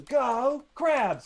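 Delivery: loud, high cheerleader cheer. Acted. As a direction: shout it like a cheer. Cheerleader cheering her mascot.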